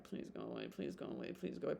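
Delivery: soft, desperate please go away under the breath; pleading murmur. Pretend. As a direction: beg him to leave, barely aloud. Fanny pacing, wishing him gone.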